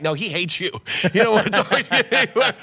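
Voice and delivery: teasing his wife while laughing; laughing tease. Retold or imitated. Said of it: "I'm like".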